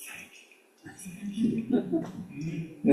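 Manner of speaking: barely audible whispered thanks; faint whisper. Retold or imitated. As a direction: murmur it while driving off.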